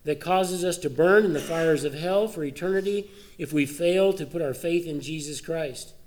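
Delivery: lifted, urgent preacher's voice; fervent preaching. Real, not acted. Pastor stirs the congregation, lifted and urgent. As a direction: press the point home from the pulpit.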